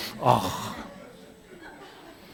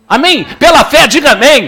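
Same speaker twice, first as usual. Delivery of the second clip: higher, louder call for amen; 9 semitones higher, 5 dB louder than usual. Real, not acted. Preacher urging the church to answer amen, louder. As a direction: rouse the crowd.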